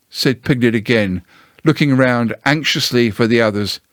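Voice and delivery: ordinary delivery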